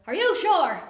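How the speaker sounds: loud, high swooping grandmother voice; East Coast grandmother. Retold or imitated. Grandmother checking with uncle before the ice bucket, colorful East Coast inflection. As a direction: holler it with big swinging inflection. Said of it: "about the way that you".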